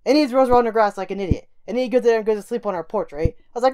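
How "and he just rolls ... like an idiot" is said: ordinary delivery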